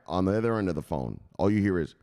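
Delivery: usual speaking voice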